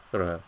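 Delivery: "ra" with a rolled trilled r. Shown as a demonstration. Teacher demonstrates southern trilled r in ra. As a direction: roll the r in "ra". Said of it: "Some people may trill it".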